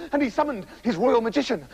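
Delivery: ordinary delivery, nothing marked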